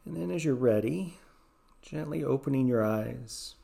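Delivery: soothing guided meditation voice. Acted. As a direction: ease the listener through each breath.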